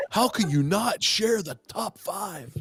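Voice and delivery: high, slow indignant whine; mock outraged viewer. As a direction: play the pissed-off fan. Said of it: "They were like".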